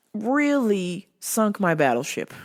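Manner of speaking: ordinary talk, nothing marked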